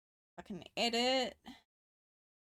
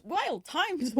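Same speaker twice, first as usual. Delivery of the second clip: higher, mock-dramatic; 7 semitones higher than usual. Shown as a demonstration. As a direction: echo it up higher for effect.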